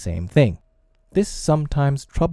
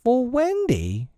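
slow, high-pitched incredulous echo; aghast; 14 semitones higher, slower than usual. A narrator's reading. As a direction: repeat it appalled, voice shooting up. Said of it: "John said aghast".

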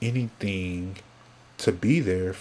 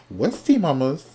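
high, bright playful greeting; playful excited; 7 semitones higher, 5 dB louder than usual. Real, not acted. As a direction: toss it out cheekily to the fans. Playful catchphrase to the audience.

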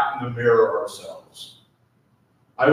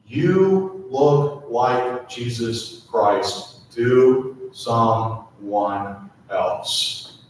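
ordinary talk

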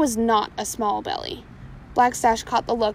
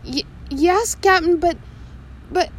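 frightened stammer; 6 semitones higher, 4 dB louder than usual. Acted. Frightened mate stammers at captain.

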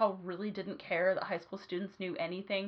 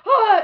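high, loud mock shriek of alarm; a short, hammed-up panicked yelp; mock panicked scream; 13 semitones higher, 16 dB louder than usual. Students panicking at the teacher's question, mock shriek. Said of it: "And we're like".

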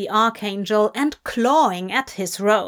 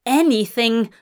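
usual speaking voice

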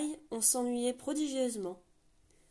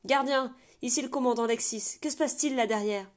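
louder, commanding call through the door; 4 semitones higher, 7 dB louder than usual. Acted. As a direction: project to someone behind a gate. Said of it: "lancer d'une voix forte". (le commandant crie à travers la porte, voix forte)

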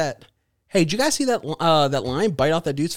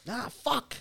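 high, small, restrained yelp; calm understated pain; 11 semitones higher, 7 dB quieter, slower than usual. Retold or imitated. Imitating man bitten by lion, oddly calm yelp. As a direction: softly mimic a muted reaction to a bite. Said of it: "That guy was, rem-, like, remarkably calm".

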